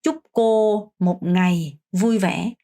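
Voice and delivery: slow, clear Vietnamese wish; slow model phrase. Shown as a demonstration. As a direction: say it slowly for learners to copy. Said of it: "라고 하시면 됩니다". (천천히 또박또박 인사 시범)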